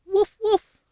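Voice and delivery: high, cutesy puppy bark, hammed-up; playful woof. Showing the doll's puppy to viewers, a high playful woof. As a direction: bark like a tiny toy dog, high up.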